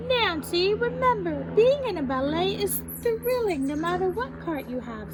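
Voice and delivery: high, loud, emphatic mother; mom's reminder. A narrator's reading. Mom reminds Nancy about her part, emphatic and high. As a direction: pitch it up and press the reminder home.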